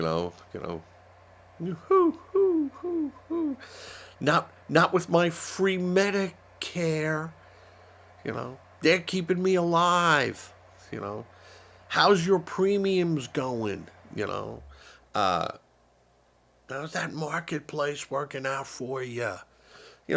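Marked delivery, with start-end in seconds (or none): 1.6-3.6 mocking hoots. Mock hooting at protesters. 4.2-19.4 gloating retort. Pretend. Imagined retort to protesters.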